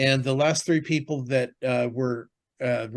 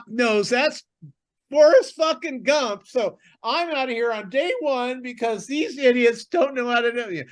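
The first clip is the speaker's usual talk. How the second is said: very high, loud mock tirade; imitated screaming rant; 11 semitones higher, 5 dB louder than usual. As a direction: scream like a furious contestant storming out. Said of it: "this person's screaming their head off".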